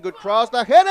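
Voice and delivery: rising excitement. Real, not acted. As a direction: voice climbing as the header comes. Goal build-up, commentator rising.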